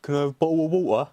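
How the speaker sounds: mock cockney. Mocking British accent stereotype.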